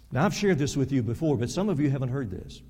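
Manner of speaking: normal talking voice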